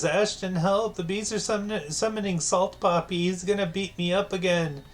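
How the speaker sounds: high, hammed-up cry for help; mock panicked plea. Reads chat plea in high voice.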